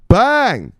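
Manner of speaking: loud, high BANG; shouted catchphrase. Real, not acted. Host cheering a vote on crypto, shouted.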